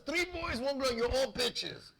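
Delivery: high, drawn-out mock rebuke; drunk father. Father telling off his kids, high imitated rant. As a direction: imitate dad's boozy put-down. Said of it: "he used to tell us".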